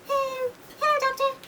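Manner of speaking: very high, whiny sick-cat voice; a poorly little cat greeting the doctor, pitched high; Mister Cat. Pretend. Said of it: "Mister Cat".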